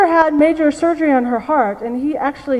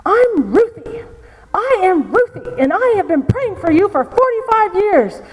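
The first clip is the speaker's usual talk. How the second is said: put-on funny old lady voice; 7 semitones higher than usual. Grandmother confronting the man who left her, high and funny. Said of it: "she talks in this very funny voice".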